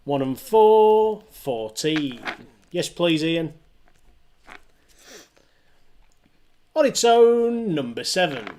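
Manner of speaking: sing-song rising then falling number calls; bingo caller. Acted. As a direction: call each number like a bingo hall. Online bingo number calling.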